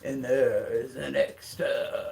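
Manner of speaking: low, slow spooky narrator voice; deep creepy storyteller read; vampire book narrator. Retold or imitated. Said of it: "any vampire book that you're like".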